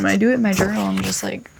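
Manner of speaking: usual speaking voice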